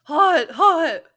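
high, loud yelps of "hot"; hammed-up Naruto slurping scalding ramen; Naruto burning his tongue. Naruto eats scalding ramen, loud high yelps.

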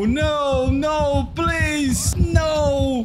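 rising panicked no, no, please; desperate pleading shout. Real, not acted. Begging as killer takes him. (súplica desesperada aos gritos)

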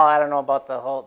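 ordinary delivery

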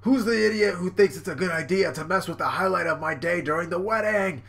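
loud, high, hammed-up rage; booming mock tirade; furious Big Mom. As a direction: rant like an outraged villain queen.

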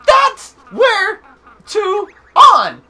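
high, loud, isolated words; peppy character. Acted. Player voicing the tutor skipping words, high and loud. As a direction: shout odd words in a peppy voice. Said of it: "Why are you skipping every other word?".

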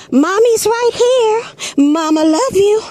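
high, slow, calm mother's reassurance; mother soothing her child. Retold or imitated. Mother calling down to her boy in the moat, high and slow. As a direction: coo it like a mom calming her kid. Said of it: "you hear the mama say".

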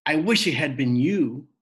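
loud bitter scream. A narrator's reading. Rob screaming at his father, loud and bitter. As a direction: spit the wish out loud. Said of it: "he screamed".

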